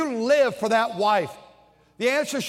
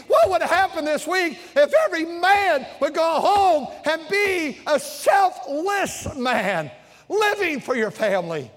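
preaching climax; 7 semitones higher than usual. Real, not acted. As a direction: drive the appeal home.

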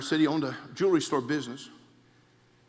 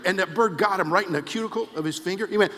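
normal talking voice

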